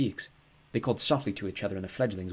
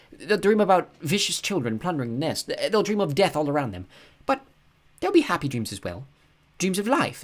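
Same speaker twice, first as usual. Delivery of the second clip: put-on higher young girl's voice, intent and a little louder; a girl sharing a vision, pitched up; Mina, earnest girl; 8 semitones higher, 5 dB louder than usual. A narrator's reading. Girl describing baby birds' dreams, in a higher voice.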